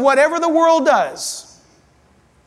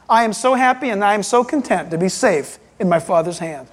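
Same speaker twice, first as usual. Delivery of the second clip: ordinary talk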